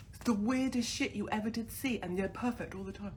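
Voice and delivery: usual speaking voice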